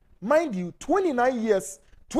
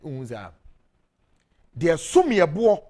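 ordinary talk, nothing marked

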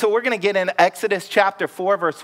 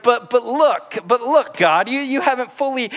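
higher, stammering whine; insisting like a nagging child; whining Moses; 6 semitones higher than usual. Acted. Moses whining at God, pitched up and insistent. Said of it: "kinda whining".

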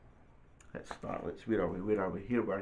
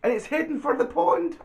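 high, urgent storybook narration; mock panic; 6 dB louder than usual. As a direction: announce the danger with rising alarm. Acted alarm at runaway pram.

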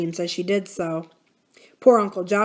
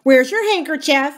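higher, louder scolding question; stern parrot; 8 semitones higher, 8 dB louder than usual. A narrator's reading. Parrot imitating Aunt Polly at Uncle Jocelyn, stern. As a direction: demand it sternly in a raised voice. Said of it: "said Kiki sternly".

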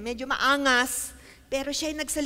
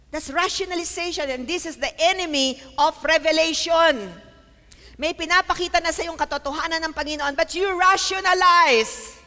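high, loud pulpit delivery building in force; forceful preaching; 4 semitones higher, 5 dB louder than usual. Real, not acted. Preacher confronting those who rationalize revelation, preaching at full force. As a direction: build to a loud, stretched final word.

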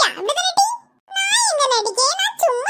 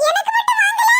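put-on indignant child shout; 6 semitones higher than usual. Child left out of ice cream.

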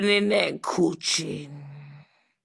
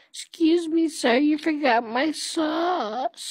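customer voice; 8 semitones higher, 5 dB quieter than usual. Acted. Customer voice in comedy bit.

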